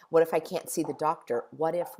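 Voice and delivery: ordinary talk, nothing marked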